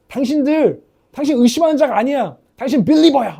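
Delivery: emphatic mock telling-off; retold scolding. Coach scolding fans who leave early, mock-stern telling-off. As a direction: imitate a coach scolding the crowd, punchy.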